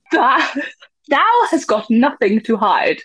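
slow, deliberate mock-Shakespearean declamation; theatrical Shakespearean. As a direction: play the hammy stage thespian. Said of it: "I'll do it Shakespearean".

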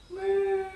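put-on high playful moo for the kids; cow moo. Shown as a demonstration. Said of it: "make the cow noise".

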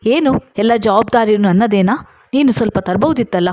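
ordinary delivery, nothing marked

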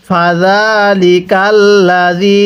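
drawn-out Arabic recitation, slow; slow Quran recitation. Shown as a demonstration. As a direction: recite slowly for students to repeat. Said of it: "হ্যাঁ, পড়ুন". (ধীরে কুরআন তিলাওয়াত)

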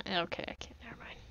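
soft unvoiced whisper. Real, not acted. Player gives up on the light, whispering to himself. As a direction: drop to a breathy whisper to yourself.

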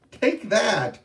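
high, loud hit line; mock attack. Ghost sister hitting back in the fight, loud. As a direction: strike with the words.

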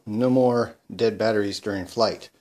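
ordinary delivery

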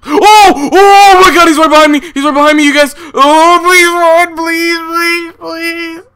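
high, loud shrieking pleas; terrified screaming. Real, not acted. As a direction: scream for your friends to run, truly scared. Monster right behind him.

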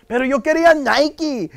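put-on a kid begging for the expensive Nike; whiny kid. Kid whining to mom about Nike, high pleading voice.

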